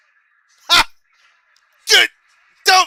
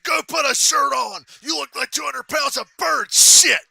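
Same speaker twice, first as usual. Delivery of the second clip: slow, higher, louder insult; angry shout; 11 semitones higher, 13 dB louder than usual. Retold or imitated. Dad yelling at his son to put a shirt on, angry shout. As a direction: roar the order.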